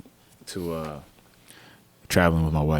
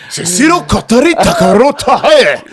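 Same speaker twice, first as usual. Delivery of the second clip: made-up Japanese-sounding gibberish, high and laughing; fake foreign language; 7 dB louder than usual. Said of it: "I just made it up".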